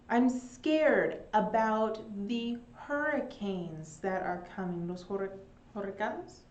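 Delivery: ordinary delivery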